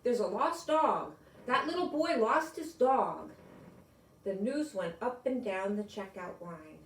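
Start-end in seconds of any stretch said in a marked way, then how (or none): 0.0-3.3 calling out. A narrator's reading. Shoppers calling out the news.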